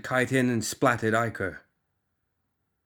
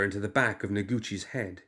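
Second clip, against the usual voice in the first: ordinary delivery, nothing marked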